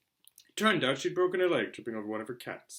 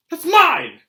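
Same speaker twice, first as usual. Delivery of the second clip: much higher, loud protest; protest cry; 18 dB louder than usual. Acted. Harry protesting as his letter is snatched, a loud high cry. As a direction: cry out to get it back.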